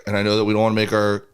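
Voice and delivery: usual speaking voice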